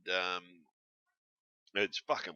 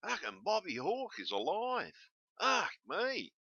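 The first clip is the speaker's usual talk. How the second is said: excited surprise; 12 semitones higher, slower than usual. Real, not acted. Telling viewers Bob Hawke is alive, amazed high-pitched surprise. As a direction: voice jumps up high in amazed disbelief.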